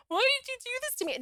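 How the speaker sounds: high, rushed mock wail; mock sobbing upset. Woman showing how she didn't react to him, mock sobbing. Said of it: "like, the sobbing, upset".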